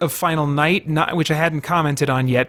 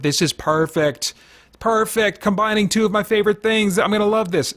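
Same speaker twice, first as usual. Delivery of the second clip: higher, building into eager excitement; remembered excitement; 6 semitones higher than usual. Retold or imitated. Fan recalling first hearing of the crossover, gushing excitedly. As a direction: replay the thrill of a fan hearing great news. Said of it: "at the time, I thought".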